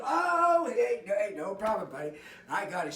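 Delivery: loud, high, hearty welcome; effusive host greeting. Retold or imitated. Giuseppe calls him over, loud and high and hearty. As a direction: greet him like a long-lost pal.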